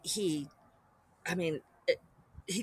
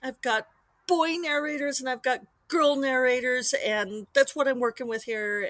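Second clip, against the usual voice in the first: higher, louder mock-insistent push back; retold firm refusal, a touch exasperated; insistent retort; 5 semitones higher, 3 dB louder than usual. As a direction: dig in your heels, lightly. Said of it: "And it's like".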